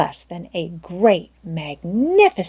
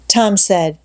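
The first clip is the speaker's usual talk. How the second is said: ordinary delivery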